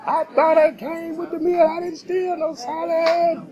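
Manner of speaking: very high-pitched mock outrage; loud, belligerent mimicry. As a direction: mimic an indignant customer way up high. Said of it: "starts getting loud and belligerent".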